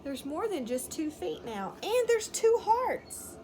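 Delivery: usual speaking voice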